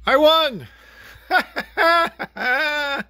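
high, laughing in triumph; gloating laugh. Real, not acted. Taunting Sal with a triumphant laugh. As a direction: cackle over the win. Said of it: "gloating at Sal".

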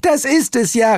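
very high, exasperated shriek; shrieking cartoon outrage; screeching. A narrator's reading. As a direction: screech back at the cousin. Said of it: "kreischte Hel".

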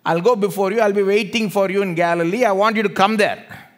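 high, very loud impassioned sermon delivery; full-force preaching. Acted. Jesus calling disciples to Galilee, loud impassioned preaching. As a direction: build a rousing promise at the top of the voice.

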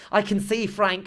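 high, loud, thrilled cry; animated, elated. A narrator's reading. Molly telling her husband she can see, elated. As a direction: cry it out in buoyant excitement. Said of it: "I never saw her so animated".

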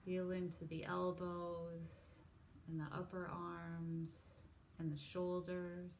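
unhurried, soft, even meditation cueing; guided meditation. Real, not acted. Leads a body scan meditation.

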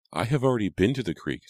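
lightly voiced, assertive little girl; young sister. A narrator's reading. Abigail turns down the creek, in girlish protest.

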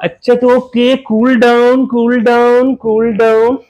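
high teasing calm-down; playful soothing. Pretend. Husband playfully calming angry wife, high teasing tone. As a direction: coax her, half joking.

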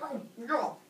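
high voice, surprised taste; high startled chatter. Pretend. Kronos tasting baby.

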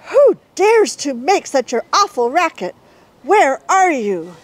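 ready-to-fight animal voice; fierce challenger. Acted. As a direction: call out to find the intruder.

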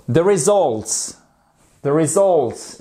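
slow "results", ri-ZULTS, stressed second syllable; correct pronunciation. Shown as a demonstration. Teacher models the tricky word for learners, careful pronunciation. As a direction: lean on the open vowel in "results". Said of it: "et pas the results".

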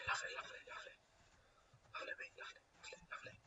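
barely audible whispered repetition; hushed muttering. Real, not acted. Gamer murmuring to himself over his loot, very hushed. Muttering to self while playing.

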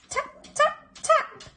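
tiny, very high pecks; tapping sound. A narrator's reading. Narrator voices sound from the eggs, high little taps. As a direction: squeak out each tap, short and separate.

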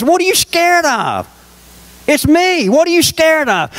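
a voice reaching frightened men across the water; voice of Jesus. Retold or imitated. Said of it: "It says".

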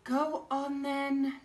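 slow, weary go on then; tired mum sighing yes. Acted. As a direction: give in with a sigh. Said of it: "sighed Missus Large".

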